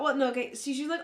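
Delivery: ordinary delivery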